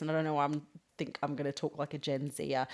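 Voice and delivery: ordinary delivery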